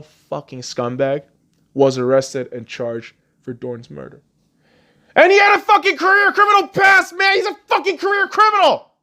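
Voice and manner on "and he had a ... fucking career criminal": much higher, much louder enraged yelling; furious shout. Real, not acted. Vlogger raging about the killer's record, in a furious shout. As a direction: blast it out in real fury.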